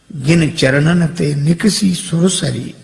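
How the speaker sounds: slow, reverent quoting of a verse; devotional verse. Acted. Preacher quotes the verse on the holy feet, reverently. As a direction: linger over the holy line.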